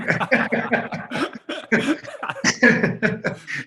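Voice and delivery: long, voiceless wheezing laugh; real laugh. Real, not acted. Athlete laughing at his own joke about not acting, real laughter. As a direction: crack up at your own punchline.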